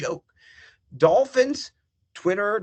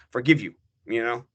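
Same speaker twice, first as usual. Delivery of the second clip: ordinary talk, nothing marked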